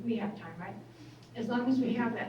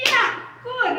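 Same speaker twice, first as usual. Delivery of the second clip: much higher, louder, bright "Yeah, good"; cheery approval; 11 semitones higher, 10 dB louder than usual. Retold or imitated. Healer cheerfully welcoming a client's betrayal story, loud and high. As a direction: pop up with a delighted, exaggerated yes. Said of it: "I'm like".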